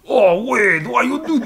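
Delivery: high, loud mock Russian-ish Colossus; a hammed-up Colossus whine. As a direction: do Colossus pleading with his friend Wade. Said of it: "from his voice and talks like this".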